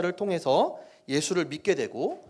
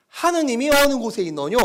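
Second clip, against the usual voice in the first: North Korean dialect; 6 semitones higher, 5 dB louder than usual. Shown as a demonstration. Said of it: "북한 사투리를 쓴다고 생각하고 읽으시면".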